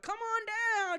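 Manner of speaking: high, loud announcer call; game show host. Retold or imitated. Host calling the contestant down, loud high announcer. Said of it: "it'll be like The Price is Right".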